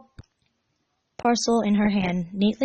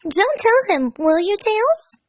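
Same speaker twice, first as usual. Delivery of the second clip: Amy whispering; 13 semitones higher, 5 dB louder, slower than usual. Acted. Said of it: "Amy whispered".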